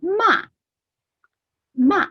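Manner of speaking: ma falling sharply from high; ma che cade netto dall'alto; fourth tone. Shown as a demonstration. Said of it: "it goes like this".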